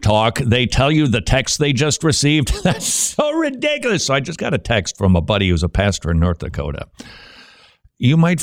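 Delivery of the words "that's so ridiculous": high laughing scoff; amused laughing disbelief. Real, not acted. Laughing at a caller's gimmick.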